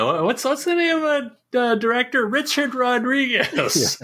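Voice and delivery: high put-on mom voice; imitating a mom. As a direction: grope for the name as a confused mom. Playing the skit's confused mom.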